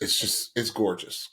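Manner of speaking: normal talking voice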